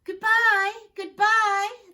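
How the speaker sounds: high, loud goodbyes; calling out. Acted. Students calling goodbye while rushing out the door, high and loud. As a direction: shout it across the room. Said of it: "the students all called".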